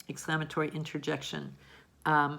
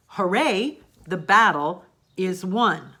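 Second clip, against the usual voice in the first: raised, loud exclamation with drawn-out words; exclaiming; 2 semitones higher, 9 dB louder, slower than usual. Acted. Teacher reading a cheering example sentence to students, exclaiming. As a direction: call out a mild cheer, loud and slow.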